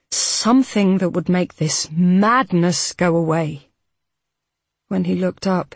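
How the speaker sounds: ordinary delivery, nothing marked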